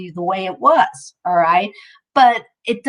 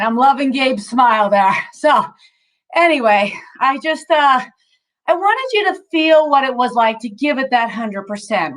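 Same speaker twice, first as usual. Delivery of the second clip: ordinary talk, nothing marked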